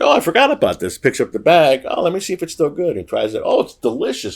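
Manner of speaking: high, light mock surprise; chirpy discovery of the coffee; Lita imitated. Lita finding old coffee in her cabinet, chirpy. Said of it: "She's like".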